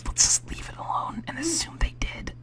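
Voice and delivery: playful breathy whisper; giggly prank whisper. Pretend. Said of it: "are we doing a whispering thing now?".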